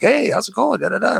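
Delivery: high, bright mock pickup line; smooth flirting. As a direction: greet her like a would-be charmer. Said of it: "I'm like".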